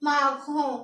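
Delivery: माखों with a throaty r and nasal ending; French pronunciation of माखों; French marron. Shown as a demonstration. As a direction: say माखों as ma-khon, final n swallowed. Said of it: "क्या पढ़ेंगे?".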